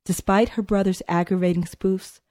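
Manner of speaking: ordinary delivery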